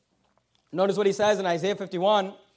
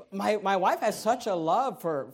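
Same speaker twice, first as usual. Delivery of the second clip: normal talking voice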